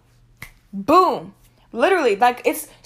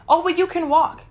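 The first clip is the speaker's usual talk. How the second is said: higher mock-mom retort; lightly mocked mom; 6 semitones higher, 4 dB louder than usual. Mom refusing her daughter a ride, lightly mocked. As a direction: imitate a dismissive mother, pitched up.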